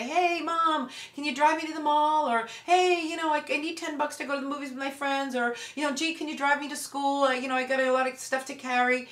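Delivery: put-on breezy teen who forgot the fight; teen asking favors.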